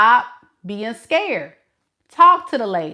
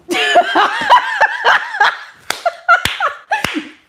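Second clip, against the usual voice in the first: long, high-pitched laughing cackle; giddy cackle. Acted. Woman on the phone with her girlfriend, giddy cackle.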